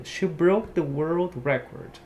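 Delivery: slow model sentence, record stressed REcord; example sentence. Shown as a demonstration. Teacher modelling the noun record for students. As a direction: read it slowly for learners. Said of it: "Ela quebrou o recorde mundial".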